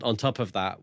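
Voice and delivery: ordinary talk, nothing marked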